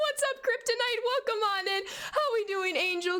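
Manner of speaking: high greeting through leftover laughter; laughing greeting. Real, not acted. Still laughing while greeting Kryptonite.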